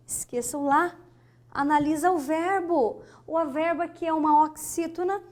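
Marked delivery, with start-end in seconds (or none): none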